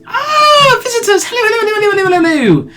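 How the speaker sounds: very high, loud gushing greeting; lonely hermit thrilled by visitors; delighted old woman. Acted. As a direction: squeal with joy at guests.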